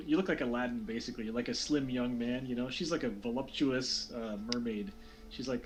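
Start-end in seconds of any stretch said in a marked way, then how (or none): none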